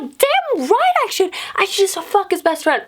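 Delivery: high-pitched, fired-up rant at normal volume; retold angry yelling. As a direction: act out ranting to your girlfriends, pitch way up. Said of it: "So I'm, like, yelling".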